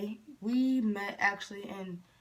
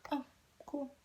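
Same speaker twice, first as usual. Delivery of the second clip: fake nonchalance; 6 semitones higher, 6 dB quieter than usual. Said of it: "pretended not to care".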